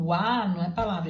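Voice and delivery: ordinary delivery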